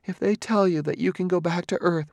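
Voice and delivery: high, doubting voice; Gladia, challenging. A narrator's reading. Gladia questions Bailey's promise about Jander, challenging.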